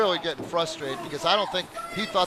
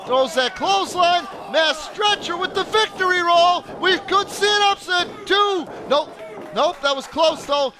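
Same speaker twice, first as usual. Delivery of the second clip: high, loud near-fall call; excited play-by-play; 10 semitones higher, 5 dB louder than usual. Real, not acted. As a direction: shout it like the match could end.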